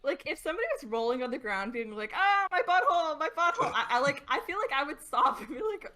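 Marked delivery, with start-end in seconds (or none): 2.2-3.7 raised, louder fake wailing; hammed-up hurt yelp; mock pain. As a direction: cry out in pretend agony. Imitating someone rolling in pain.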